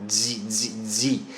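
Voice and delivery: Z as a soft voiced buzz. Shown as a demonstration. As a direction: hum a soft zz. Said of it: "Z입니다".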